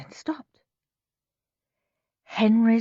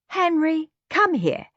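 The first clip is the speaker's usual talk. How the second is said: higher, sugary mother's voice; doting mum; 9 semitones higher than usual. A narrator's reading. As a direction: gush like a proud mother. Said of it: "said Mum".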